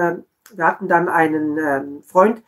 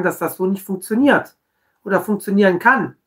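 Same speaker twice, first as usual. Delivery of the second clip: normal talking voice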